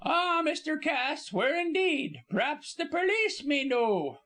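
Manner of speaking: high, drawn-out insinuation; high-pitched servant dropping dark hints; gossiping Cockney servant woman. Acted. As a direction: stretch it out knowingly in a high Cockney voice.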